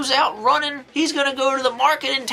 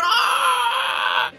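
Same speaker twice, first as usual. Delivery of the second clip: high yelp as if stabbed; hammed-up scream of a boy hit by a stick; mock scream of pain; 8 semitones higher than usual. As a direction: let out a short pained cry, pitched up. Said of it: "and he's screaming".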